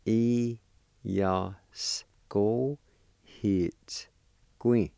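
"E, ya, s, ko, hits, gui" each held alone; long pauses between "E, ya, s, ko, hits, gui"; slow syllable-by-syllable. Shown as a demonstration. Teacher showing how it's pronounced, slow syllable-by-syllable. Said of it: "like this".

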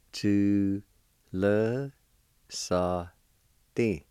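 very slow, a gap after each sound; syllable by syllable. Shown as a demonstration. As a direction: sound out "Tu le sa din" piece by piece. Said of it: "It's pronounced like this".

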